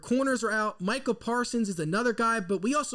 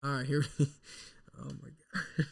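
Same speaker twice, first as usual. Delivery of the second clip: ordinary talk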